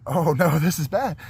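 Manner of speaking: a touch of mock alarm; retold alarm. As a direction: replay a moment of dawning dread. Retells his alarm on bridge.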